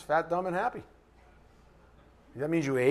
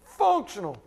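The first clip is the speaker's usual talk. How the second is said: "Functional" repeated, higher and stressed; emphasis; 5 semitones higher, 3 dB louder than usual. Shown as a demonstration. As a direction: lean on "Functional" again. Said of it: "said it with that kind of emphasis".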